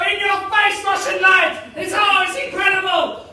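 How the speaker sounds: high, loud victory shout; triumphant gloating. Acted. Stair racer celebrating against his Russian rival, hammed-up triumphant shout. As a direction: crow over a beaten rival.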